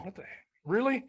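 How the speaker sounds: high-pitched mock bafflement; retold disbelief. Parent retelling being handed the welding job, incredulous. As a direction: replay your baffled reaction, high.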